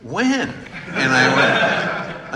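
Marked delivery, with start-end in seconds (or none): 0.0-0.5 high, sharp puzzled question; puzzled repairman. Retold or imitated. Repair guy quizzing the former governor, puzzled and high. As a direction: press him with a doubtful question.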